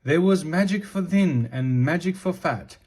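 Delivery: plain reading voice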